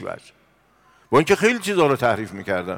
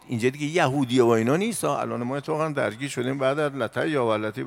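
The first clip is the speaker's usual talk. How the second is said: ordinary talk, nothing marked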